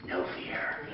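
imitated intense whisper; breath-only, softened delivery; hushed declaration. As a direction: whisper it like a private victory. Friend's triumphant hushed declaration, retold.

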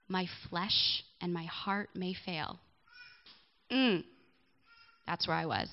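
a gentle, measured Bible reading; scripture reading. Acted. Reads Psalm aloud.

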